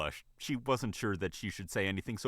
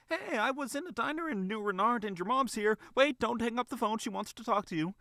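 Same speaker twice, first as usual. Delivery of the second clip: high, chirpy bunny voice; excited phone call; 13 semitones higher than usual. Acted. Bunny imagining a call to Nick, excited. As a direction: gush it in a high-pitched rush of news.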